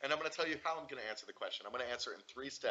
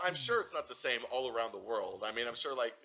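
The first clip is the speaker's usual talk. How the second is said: ordinary talk, nothing marked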